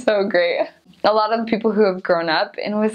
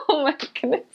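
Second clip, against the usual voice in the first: amazed; 6 semitones higher, 4 dB quieter, much faster than usual. Retold or imitated. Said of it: "And I was like".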